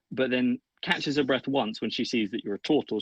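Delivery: ordinary talk, nothing marked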